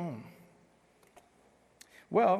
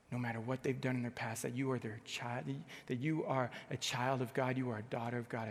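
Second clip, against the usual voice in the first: normal talking voice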